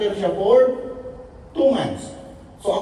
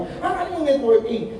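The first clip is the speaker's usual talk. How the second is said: surprised laughing; 9 semitones higher, slower than usual. Retold or imitated. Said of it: "Sabi ko".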